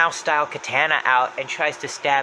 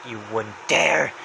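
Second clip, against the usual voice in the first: low, slow warning; Happy's voice; 4 semitones lower, slower than usual. Retold or imitated. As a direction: draw it out as a controlled threat. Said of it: "comes closer to him saying".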